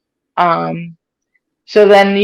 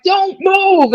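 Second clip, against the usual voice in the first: much higher, firm order; EMT command; 12 semitones higher than usual. Retold or imitated. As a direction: tell her not to move, urgently. EMTs telling her to stay.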